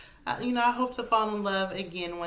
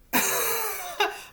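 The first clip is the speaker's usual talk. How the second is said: high burst of laughter. Real, not acted. Laughing after the shock.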